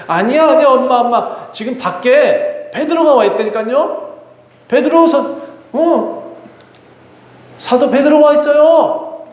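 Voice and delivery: put-on high, pleading child's voice; a little girl desperate to be believed; insistent child. (믿어달라고 엄마에게 매달리는 아이)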